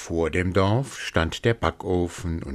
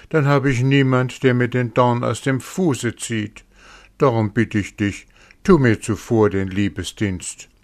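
higher, louder pleading mare; higher-voiced horse begging a favour; cunning horse; 5 semitones higher, 5 dB louder than usual. A narrator's reading. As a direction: coax the wolf with flattery. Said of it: "Das Pferd sprach".

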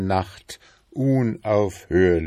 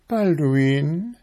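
high-pitched, fussy wife voice, louder; nagging wife beetle; 10 semitones higher, 4 dB louder than usual. A narrator's reading. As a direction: pester him in a high, insistent voice. Wife calls husband.

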